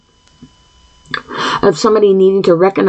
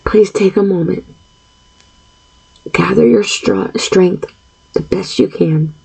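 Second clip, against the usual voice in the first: calming guided breathing; 2 semitones lower than usual. Real, not acted. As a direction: ease the listener into one slow breath.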